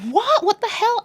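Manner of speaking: high-pitched shocked reaction; mock shock. The family all hear the news, mock shocked. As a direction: imitate a scandalised family.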